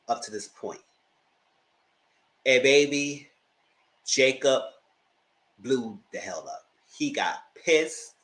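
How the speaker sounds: ordinary talk, nothing marked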